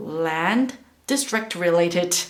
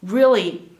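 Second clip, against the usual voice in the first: ordinary talk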